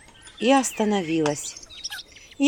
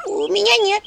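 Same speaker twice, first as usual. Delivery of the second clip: high squeaky imp voice; little imp voice; 12 semitones higher, 4 dB louder than usual. Acted. Imp Anchutka quickly saying she has none. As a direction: chirp it like a small imp. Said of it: "тут же заметила Анчутка".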